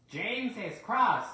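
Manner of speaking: high, loud, slow spiteful snicker. Acted. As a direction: gloat with a nasty snigger. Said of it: "snickered the spiteful brakeman".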